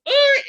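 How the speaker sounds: high, loud pained ugh; anguished groan. Retold or imitated. Parent hearing her child cry, pained high groan. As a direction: let out a strained groan of helplessness.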